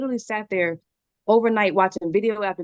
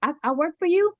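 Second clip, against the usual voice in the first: high-pitched little grandson voice; four-year-old grandchild; 7 semitones higher than usual. Retold or imitated. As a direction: imitate a small child piping up to Nana. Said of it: "my four-year-old grandchild".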